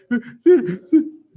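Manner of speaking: high, loud chuckle; amused laugh. Acted. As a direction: laugh it off gently. Father laughs at boy.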